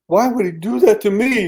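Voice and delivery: high, pained imitation of a crying woman; tearful plea. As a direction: ask it through tears, pitched high. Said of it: "she cries".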